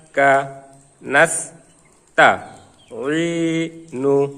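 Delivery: each Arabic syllable alone, with a pause after it; teacher's syllable-by-syllable recitation drill; broken-up syllables. Shown as a demonstration. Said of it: "ভাঙ্গা ভাঙ্গা উচ্চারণ করব". (ভাঙ্গা ভাঙ্গা করে উচ্চারণ)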